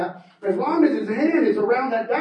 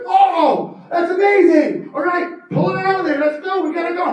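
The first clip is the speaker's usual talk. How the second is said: excited urging; 9 semitones higher, 7 dB louder than usual. Acted. Cassandra urging Fernando.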